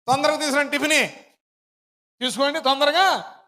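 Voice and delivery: imitating an impatient boy at the door; a retold boy hurrying everyone along; hurried boy. Boy rushing in to deliver.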